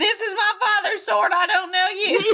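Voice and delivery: high, laughing parody of the line; mock cadence. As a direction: ham up the same cadence. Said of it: "in the exact same cadence".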